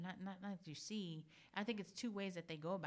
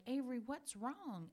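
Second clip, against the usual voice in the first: worried coaxing; 7 semitones higher, slower than usual. Retold or imitated. Mom finds son hiding.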